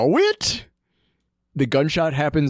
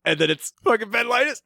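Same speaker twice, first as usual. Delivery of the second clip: ordinary talk, nothing marked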